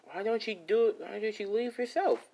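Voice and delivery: high-pitched little gingerbread creature; gingerbread man. Acted. Gingerbread man challenging Izuku, in a high little voice. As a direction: pipe up high, questioning the boss.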